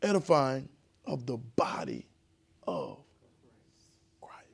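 slow, hushed whispered reading; reverent whisper. Shown as a demonstration. Teacher showing the class how to read with reverence, whispered slowly. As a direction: breathe the words out with reverence. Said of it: "I want you to say it a little slower".

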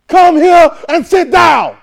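slow, high, loud shouted order; yelling. Shown as a demonstration. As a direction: bark it out like an angry command. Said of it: "Same words. Different tone".

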